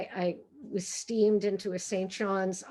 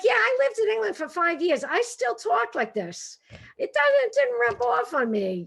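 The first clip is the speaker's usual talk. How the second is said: high-pitched, hammed-up Brooklyn accent; 10 semitones higher than usual. Brooklyn native unchanged by years in England, hammed-up Brooklyn accent. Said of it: "I still sound like I'm from Brooklyn".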